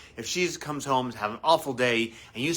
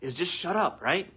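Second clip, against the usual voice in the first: normal talking voice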